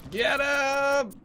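high loud yell; shouting. Real, not acted. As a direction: yell it at the team. Calling teammates in fight.

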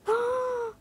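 high, sharp gasp; shocked gasp. Real, not acted. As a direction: gasp in surprise. Shocked reaction to the trade.

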